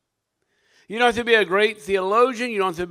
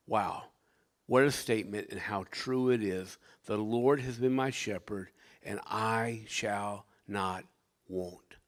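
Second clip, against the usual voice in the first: low, soft reverent reflection; subdued, low-voiced wonder fading away; hushed awe; 9 semitones lower, 8 dB quieter than usual. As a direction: marvel quietly, slowing right down at the end. Retells his quiet awe at the psalm.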